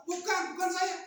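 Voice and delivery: indignant storybook squabbling; quarrelling. Acted. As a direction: snap back in a quarrel. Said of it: "Sedang berantem".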